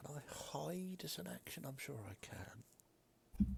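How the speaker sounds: whispering. Real, not acted. Quiet aside to self.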